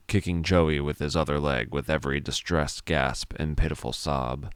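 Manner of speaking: usual speaking voice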